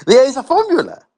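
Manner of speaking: high, loud mocking repeat; sarcastic. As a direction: scoff at the idea of a formula. Mocking the formula idea.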